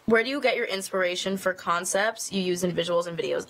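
usual speaking voice

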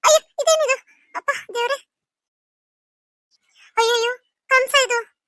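high-pitched panicked exclamation; jolted out of a bad dream; startled awake. [name] waking from a nightmare, in a high-pitched startled panic.

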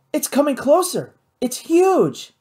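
high, excited exclaiming; thrilled excitement. A narrator's reading. Kate watches the winged shape approach, high thrilled cries.

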